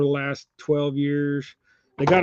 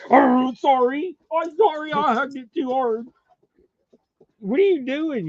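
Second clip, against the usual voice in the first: put-on baby talk to dog; 9 semitones higher, 3 dB louder than usual. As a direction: say sorry to the dog sweetly. Apologizing to hugged dog.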